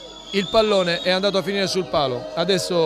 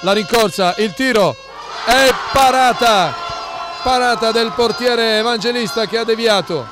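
excited save call; 2 semitones higher than usual. Real, not acted. Penalty saved, live call.

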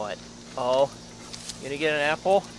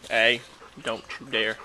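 usual speaking voice

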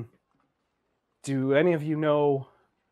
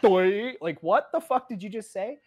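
dumbfounded; 8 semitones higher than usual. Acted. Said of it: "like that face of".

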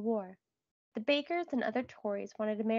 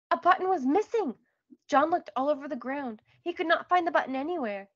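high, loud sudden alarm; alarmed narration; 5 semitones higher, 5 dB louder than usual. A narrator's reading. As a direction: narrate the loss with rising panic. Narrator voices alarm at lost button.

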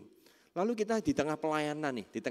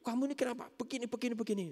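high-pitched accusing questioning; acted high, nagging reproach; judgmental scolding; 12 semitones higher, faster than usual. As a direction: imitate someone jumping straight to blame. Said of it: "dia itu tidak langsung dihakimi".

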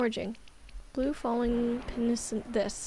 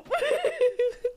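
real squeal of laughter, pitched way up; helpless high laugh; 13 semitones higher than usual. Real, not acted. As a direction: burst into a shrill giggle. Cracking up at joke.

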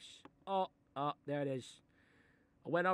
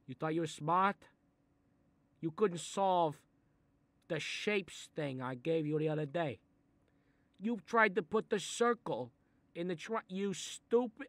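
rising angry rant, higher and louder; 4 semitones higher, 6 dB louder than usual. Acted. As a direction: tear into him. Berating the kid.

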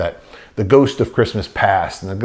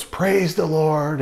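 mock scream of joy; 7 semitones higher, much slower than usual. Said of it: "he screams in the phone".

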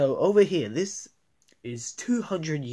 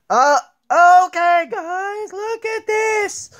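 high, slow squeaky call; squeaky toy voice; 9 dB louder, slower than usual. Acted. Toy showing friends the new playhouse, high squeaky voice.

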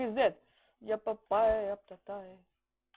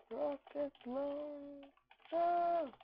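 softer, very slow, stretched syllables; drawn-out call; 3 semitones higher, 7 dB quieter, much slower than usual. Pretend. Gamer echoing his rocket launcher call, drawn-out and soft. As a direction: stretch it out slowly and softly.

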